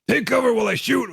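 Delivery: high shouted command; battle shout. Shown as a demonstration. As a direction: bark the order over gunfire. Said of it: "I'll be doing some things like saying".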